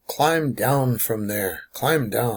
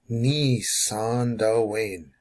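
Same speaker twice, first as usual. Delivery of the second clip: very slow, stretched "Ni san Dwayne"; syllable by syllable; much slower than usual. Shown as a demonstration. Teacher breaking down climb down for learners, very slow syllables. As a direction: draw out each syllable of the phrase. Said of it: "The syllable is gonna break here".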